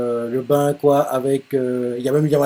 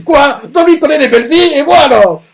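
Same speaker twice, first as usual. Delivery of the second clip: much higher and louder, jealous outrage; jealous outburst; 11 semitones higher, 8 dB louder than usual. Acted. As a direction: shout it like a jealous rival losing it. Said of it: "il a pété les plombs". (crie de jalousie, beaucoup plus aigu et plus fort)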